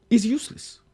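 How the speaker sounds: comic exasperation; exasperated rant. Pretend. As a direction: throw up your hands at it. Ranting about passport.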